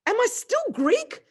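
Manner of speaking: shocked cry of Am I still Greek; stunned blurt. Retold or imitated. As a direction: gasp it out, the world just tipped over. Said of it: "I was just shocked".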